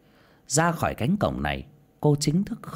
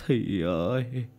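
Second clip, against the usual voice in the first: slow lament rising to loud, higher wailing; wailing grief; 10 semitones higher, 4 dB louder, slower than usual. Acted. Father at the gate wailing for his lost daughter, slow and anguished. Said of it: "miệng mếu máo".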